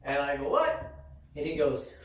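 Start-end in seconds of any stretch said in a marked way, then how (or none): 0.5-0.9 high-pitched surprised "what"; mock astonishment; startled retort. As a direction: jump up in pitch, taken aback. Retold startled reply to offer.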